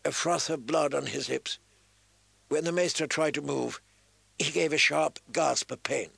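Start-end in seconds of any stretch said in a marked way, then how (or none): none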